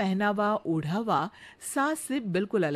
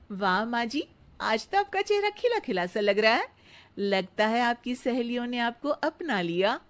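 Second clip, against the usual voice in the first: sweet daughter-in-law; 3 semitones higher than usual. Acted. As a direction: flatter the mother-in-law sweetly. Radha pleased.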